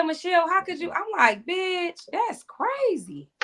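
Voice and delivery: high, slowed, drawn-out disbelief; confronting a cellmate about her dead kids, appalled. Retold or imitated. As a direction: let the horror stretch each word. Retold appalled reaction.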